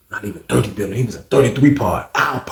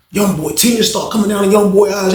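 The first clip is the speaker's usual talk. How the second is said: ordinary talk, nothing marked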